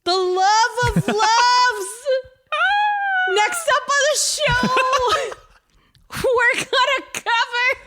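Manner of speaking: high, loud, drawn-out hype; gushing big reveal. Real, not acted. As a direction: build up the reveal with squealing excitement. Building hyped reveal of favorite character.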